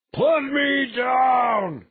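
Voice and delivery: very high, long-drawn bellow; enraged brute's shout. Acted. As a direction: bellow in protest, stretching every word. Suspended brute shouting in protest.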